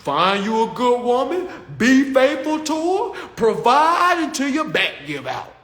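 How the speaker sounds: loud, building hammed-up lecturing elder; mock lecture rising to a shout; imitating old head lecture. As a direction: preach the old advice until it turns into a shout. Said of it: "these old heads say".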